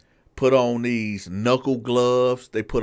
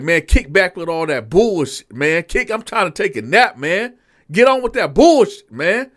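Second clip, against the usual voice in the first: annoyed inmate; 6 semitones higher, 6 dB louder than usual. Retold or imitated. Inmate brushes off captain.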